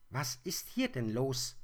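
höher, grummelig polternd. Acted. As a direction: poltere aus dem Laub heraus. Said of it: "polterte plötzlich eine Stimme". (der gestörte Igel poltert aus dem Laubhaufen)

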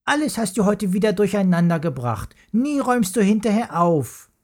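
indignant complaint. Acted. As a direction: scold him, rising in pitch. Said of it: "beschwerte sich Jula".

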